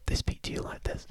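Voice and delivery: whispered right into the mic; loud, close stage whisper. Shown as a demonstration. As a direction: whisper intrusively close, as a demo. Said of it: "get real close to you".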